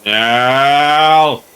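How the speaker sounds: low, drawn-out "Nail!" to find the guru voice; warming up the old guru voice; tuning word. Shown as a demonstration. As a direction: stretch the word into a deep guru voice. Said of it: "I have to say nail every time".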